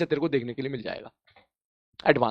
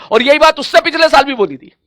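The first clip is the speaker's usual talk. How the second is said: emphatic repetition; 7 semitones higher, 6 dB louder than usual. Real, not acted. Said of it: "repeated for emphasis".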